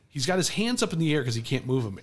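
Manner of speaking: usual speaking voice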